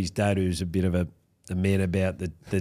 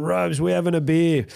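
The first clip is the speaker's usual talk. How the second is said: high, eager call at the door; retold late-night summons; imitating a rowdy mate; 8 semitones higher, 3 dB louder than usual. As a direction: rouse a mate for drinks, lightly acted. Acting mate calling at the door.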